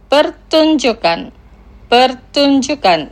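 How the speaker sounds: Pertunjukan spelled out slowly, syllable by syllable; teacher drawing out Pertunjukan slowly; slow pronunciation demo. Shown as a demonstration. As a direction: say Pertunjukan slowly and clearly for learners to repeat. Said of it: "Los pronunciaré lentamente".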